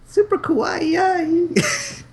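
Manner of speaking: high, drawn-out cutesy squeal; cutesy anime girl. Pretend.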